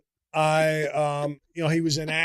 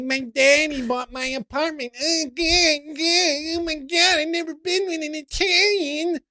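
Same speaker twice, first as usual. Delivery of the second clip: mock rich girl; 12 semitones higher than usual.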